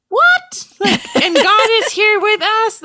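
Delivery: high, loud astonished cry; amazed. Real, not acted. Marvelling to her friend that God is here, amazed and loud. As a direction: gasp it out in wonder.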